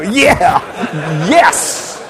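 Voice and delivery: high, loud laughing cry of delight; thrilled at being called sir, laughing it out loud; laughing yes. Real, not acted. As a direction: burst out laughing with joy.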